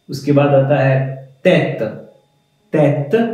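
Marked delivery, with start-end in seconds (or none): none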